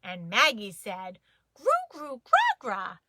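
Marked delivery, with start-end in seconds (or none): 1.5-3.0 put-on baby babble. A narrator's reading. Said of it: "'cause she's a baby".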